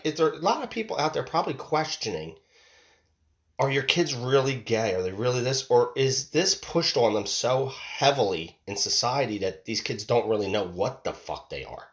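normal talking voice

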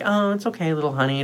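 put-on softly comforting baby talk; soothing a baby. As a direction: gently hush a baby, acted out. Soothing the crying baby, retold.

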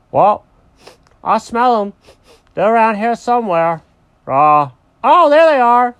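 loud, high cartoon dog on a scent; excited dog. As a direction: build to a loud discovery. Dog spots the rabbits.

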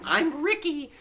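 very high, squeaky little-kid impression; imitating an over-friendly kid way up high; mock kid voice. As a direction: put on a high, eager kid voice. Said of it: "that voice right there is why I stopped".

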